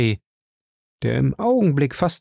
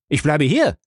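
loud defiant refusal, higher; laut und trotzig; 4 semitones higher, 3 dB louder than usual. Acted. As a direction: plant your feet and refuse. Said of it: "seine Stimme klang laut und trotzig". (laut und trotzig zum Vater)

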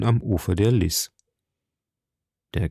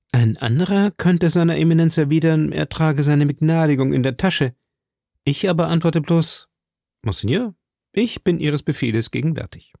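put-on higher, spirited young man's voice; confident young musketeer; 6 semitones higher than usual. Musketeer hinting at his pardon to the cardinal, confident. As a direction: answer with respectful boldness.

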